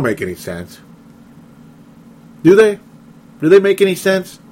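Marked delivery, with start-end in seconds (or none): 2.4-4.4 loud, high sarcastic question; mock questioning. Real, not acted. Host mocking the coaches to listeners, loud sarcastic question. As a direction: throw the question out with a jeer.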